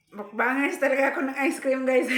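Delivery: loud, laughing, proud boast; laughing while talking. Real, not acted. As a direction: laugh through the words.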